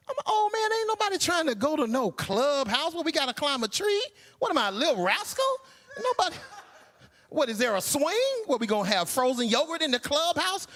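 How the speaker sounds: put-on high, whiny old-man complaint; high whiny protest. Old husband protesting to his wife, high and whiny. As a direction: squeal like a cranky old man.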